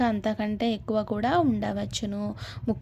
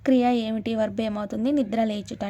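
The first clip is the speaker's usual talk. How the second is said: usual speaking voice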